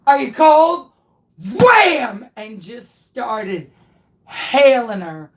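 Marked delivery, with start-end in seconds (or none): 0.1-0.9 high, loud sleepwalker's question; loud mock question. Retelling the sleepwalking, loud mock question to his wife. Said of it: "I was like". 1.6-2.3 high, loud "Wham!" sound effect; loud mock impact. Acting out the blanket hitting his wife, loud mock impact.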